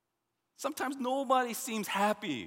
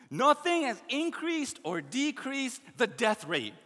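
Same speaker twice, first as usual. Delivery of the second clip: ordinary talk, nothing marked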